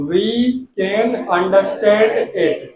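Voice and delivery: slow, stretched English words; slow dictation. Shown as a demonstration. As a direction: say it slowly for students. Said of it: "बिल्कुल धीमे बोल रहा हूँ". (धीमे धीमे पढ़ना)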